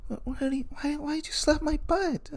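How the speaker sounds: very high, soft, coy protest; Cassandra's girly voice. Retold or imitated. Cassandra asking Rudy why he slapped her, high and coy. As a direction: complain but clearly flattered. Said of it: "she'd be like".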